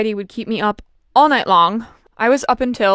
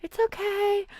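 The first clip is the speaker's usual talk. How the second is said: higher, much softer reassuring mother; comforting mom; 9 semitones higher, 10 dB quieter, slower than usual. Retold or imitated. Mom calming her crying daughter, higher and much softer. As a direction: soothe like a mom at the bedside. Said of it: "and she's like".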